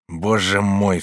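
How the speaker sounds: plain reading voice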